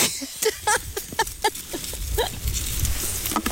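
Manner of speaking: quiet airy chuckle; a short rueful laugh under the breath; soft breathy laugh. Real, not acted. Laughs at empty bucket.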